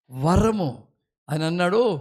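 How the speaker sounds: usual speaking voice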